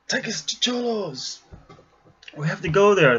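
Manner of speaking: high, pressing order to the driver; urgent request. Retold or imitated. Mary Poppins urging the taxi driver.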